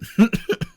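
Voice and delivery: a quick burst of laughter; bright little laugh; short high laugh. Real, not acted. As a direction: laugh at your own joke. Laughs at own bit.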